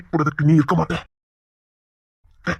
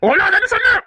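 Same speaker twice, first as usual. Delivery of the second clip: angry shout. Acted. Husband repeating order, raging.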